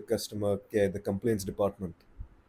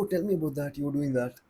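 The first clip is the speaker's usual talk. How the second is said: eager small talk; 6 semitones higher, faster than usual. Retold or imitated.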